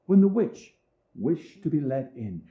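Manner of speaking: usual speaking voice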